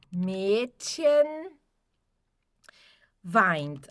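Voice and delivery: Mädchen weint, said slowly in German; German demo. Shown as a demonstration. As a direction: model Mädchen weint for the class. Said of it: "a menina chora". (pronúncia alemã de Mädchen weint)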